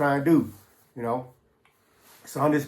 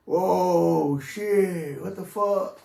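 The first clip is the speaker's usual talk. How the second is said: higher-pitched, awestruck stoner voice; blissed-out amazement; 4 semitones higher, much slower than usual. Retold or imitated. Friends on cloud nine after another line, blissed-out amazement. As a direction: imitate dudes amazed by their high. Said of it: "they swear they're freaking on cloud nine".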